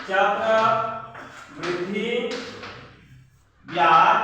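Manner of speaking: extremely slow, each word stretched; drawn-out dictation. Real, not acted. Teacher repeating the answer's heading while writing, drawn-out dictation. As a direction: pause long between the two words as you write.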